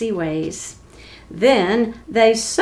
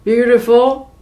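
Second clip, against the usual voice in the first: loud, rising disbelieving question; baffled, loud and rising; astonished giants; 3 semitones higher, 6 dB louder than usual. Acted. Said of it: "the giants exclaimed".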